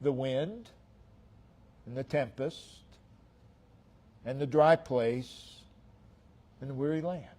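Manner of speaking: repeated sermon cadence, swelling and sinking; rhythmic preaching. Acted. Preacher building a repeated refrain.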